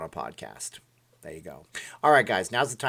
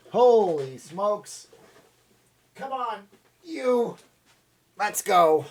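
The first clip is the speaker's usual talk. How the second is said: excited; 12 semitones higher, 6 dB louder, much slower than usual. Acted. High excited outro voice.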